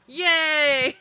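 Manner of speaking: high, loud drawn-out yay; real gleeful high cheer; delighted cheer. Real, not acted. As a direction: burst out cheering at the praise. Cheers at praise of their German.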